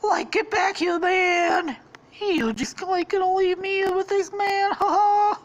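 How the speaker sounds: very high, loud cartoon plea; hammed-up high-pitched wail; desperate Shaggy. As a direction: call after a fleeing friend in panic. Shaggy abandoned by Scooby.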